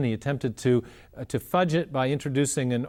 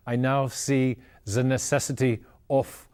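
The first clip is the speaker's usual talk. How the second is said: put-on heavy German accent. Einstein announcing his change of mind to the media, in a heavy German accent. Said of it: "in his heavy German accent".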